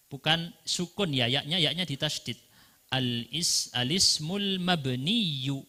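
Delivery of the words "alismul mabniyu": slow alismul mabniyu with doubled y sound at the end; tasydid on mabniyu. Shown as a demonstration. Teacher showing students how to read alismul mabniyu. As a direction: stretch out mabniyu, stressing the tasydid. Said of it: "ada tasydid di belakang seharusnya".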